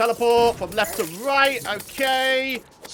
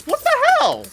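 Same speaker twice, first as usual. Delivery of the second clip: surprised outburst; 6 dB louder than usual. Real, not acted. Sudden surprise in game.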